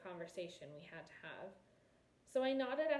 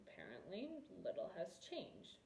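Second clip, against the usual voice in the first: muttered; 8 dB quieter than usual. A narrator's reading. Veldegrath muttering at the returned prince. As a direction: mutter it dryly to yourself. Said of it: "Veldegrath muttered".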